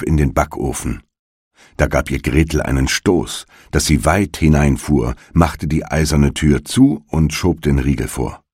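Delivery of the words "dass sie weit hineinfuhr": plain reading voice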